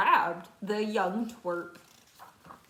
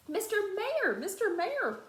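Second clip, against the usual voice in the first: high calls across a distance; calling out; 10 semitones higher than usual. A narrator's reading. Horton calls down to the mayor on the speck. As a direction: call up to the tiny mayor. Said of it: "Horton called".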